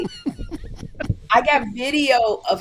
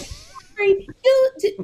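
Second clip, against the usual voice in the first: high mock nag, pitched up; mocking nagging voice; 11 semitones higher, 4 dB louder, slower than usual. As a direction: tease him like a prying neighbour. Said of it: "people coming up to him going".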